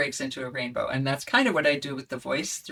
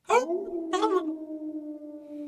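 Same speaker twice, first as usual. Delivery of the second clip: high, long howling oh; wolf howl; 13 semitones higher than usual. Shown as a demonstration. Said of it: "love to howl like a wolf".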